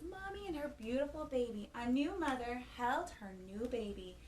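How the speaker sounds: ordinary delivery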